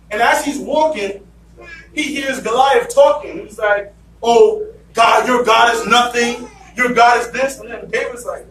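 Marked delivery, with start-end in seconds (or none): 4.2-7.6 taunting giant. Retold or imitated. Goliath taunting David's God.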